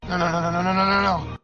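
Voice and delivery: panicked pleading. Acted. Panicking as the call fails.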